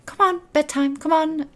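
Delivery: high-pitched chivvying; fussing auntie. Acted. Auntie fussing over child at bedtime, high-pitched chivvying. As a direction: hustle a child along in a high voice. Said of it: "starts fussing over Mario".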